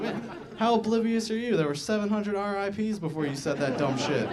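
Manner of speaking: high, incredulous scolding; mock exasperation. Comedian scolding oblivious birthday posters, mock exasperation. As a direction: start high and loud, berating in disbelief.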